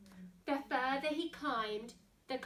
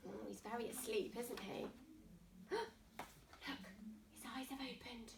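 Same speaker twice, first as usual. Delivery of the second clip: whisper; 3 semitones lower, 12 dB quieter than usual. A narrator's reading. Reader hushing the children as the lion wakes. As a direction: gasp then unvoiced whisper. Tense moment, reader hushes kids.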